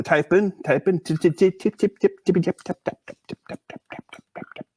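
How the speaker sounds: "tip tip tip" trailing off into whispered clicks; mock typing sounds. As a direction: imitate keyboard clatter, fading to unvoiced-sounding whisper. Mimics typing while looking up the site.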